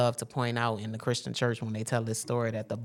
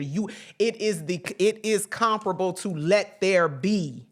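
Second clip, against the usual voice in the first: preaching; 4 semitones higher, 4 dB louder than usual. Real, not acted. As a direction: preach it with weight, stretching the declarations. Preaches the I am message.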